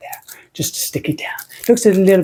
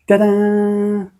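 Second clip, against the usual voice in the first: higher, held "ta-da"; playful reveal; 4 semitones higher than usual. Pretend. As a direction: present it with a little vocal flourish. Reveals the finished soap.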